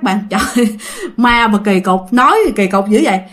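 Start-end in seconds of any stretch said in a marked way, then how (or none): none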